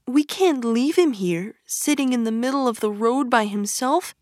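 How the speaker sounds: fretful little Mole pleading; lightly voiced timid Mole; worried. A narrator's reading. Mole worries about Toad.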